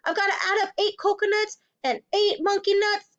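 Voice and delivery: high-pitched panicky schoolboy; anxious pupil scrambling to work it out; flustered. A narrator's reading. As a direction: plead for time in a high voice. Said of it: "Poor Wilfred was properly flustered".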